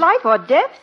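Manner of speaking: high-pitched, startled question; alarmed surprise. Acted. Wife hearing of her husband's grave legal case, alarmed. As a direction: echo it in shocked disbelief.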